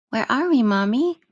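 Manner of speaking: put-on high, bright child asking; a small girl wondering aloud; little girl voice. Child voice Lilica.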